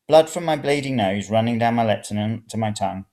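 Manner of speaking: usual speaking voice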